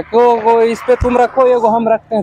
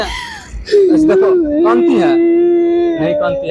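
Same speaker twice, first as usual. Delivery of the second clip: mock crying baby talk; 8 semitones higher, much slower than usual. Mimicking her crying.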